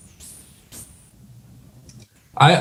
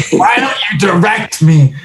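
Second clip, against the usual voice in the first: put-on higher, slurred drunk demand; drunk southern sheriff; 5 semitones higher than usual. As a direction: push it like a drunk heckler. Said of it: "in a really southern drawl".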